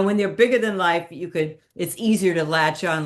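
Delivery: ordinary talk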